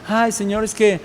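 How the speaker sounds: soft, casual mock petition; half-hearted prayer. Believer asking God to heal a brother, soft half-hearted plea. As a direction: toss off a prayer quietly, with no urgency. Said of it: "A veces nosotros nada más le pedimos".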